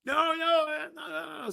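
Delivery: frantic protest. Pretend. Frantic denial to C-Mac.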